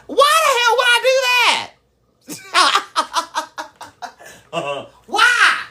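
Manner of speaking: very high, incredulous outburst; outraged squawk. Acted. Outraged retort to mother's request.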